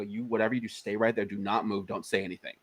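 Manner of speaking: low, quiet, firm instruction; hushed warning. Retold or imitated. Director warns child as cops arrive, hushed warning. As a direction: keep it down, tell them to freeze.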